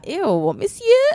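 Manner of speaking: high, slow mock French "monsieur"; drawn-out, hammed-up French accent. Friend joining the joke, slow high mock French accent. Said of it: "That's my favorite accent".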